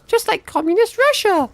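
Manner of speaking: squeaky high joke aside; a silly high squeak of a joke; mock silly voice. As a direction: pipe it up high for the punchline.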